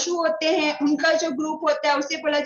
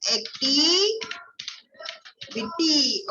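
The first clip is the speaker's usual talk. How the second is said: drawn-out answer; 3 dB quieter, much slower than usual. Shown as a demonstration. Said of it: "repeated answer word".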